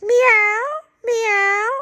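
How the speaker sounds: high, drawn-out meows; kitten meowing. Acted. Grandma reads cats and kittens, meowing high. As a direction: mew like a kitten. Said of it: "cats and kittens say".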